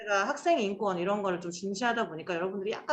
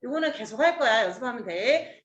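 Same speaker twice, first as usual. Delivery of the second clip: ordinary talk, nothing marked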